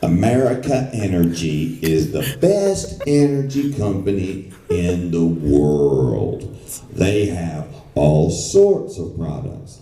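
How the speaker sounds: slow, drawn-out, overblown ad read; mock corporate pitch. Client demos his pitch to the voice actor, slow and hammy. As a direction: ham up the client's idea of a big sell. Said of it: "this is how I want it to sound".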